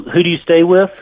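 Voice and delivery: normal talking voice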